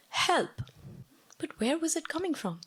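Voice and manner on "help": retold cry for help. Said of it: "A man's voice saying".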